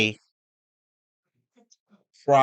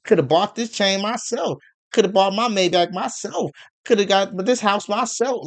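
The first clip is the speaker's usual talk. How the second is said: mocking independent woman; 7 semitones higher than usual. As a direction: sneer it out as a bragging woman, pitched up. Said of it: "They like".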